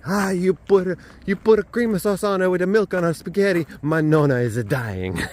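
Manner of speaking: high, loud mock Italian grandmother outrage; hammed-up Italian accent, scandalised over the spaghetti; Italian nonna accent. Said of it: "my Italian friends are crying ... my bad accent".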